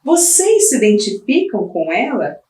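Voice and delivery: normal talking voice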